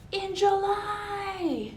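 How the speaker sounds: high, slow, drawn-out sing-song; slow lilting tease. Pretend. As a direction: stretch it out like a playful jingle. Playful Christmas in July tease.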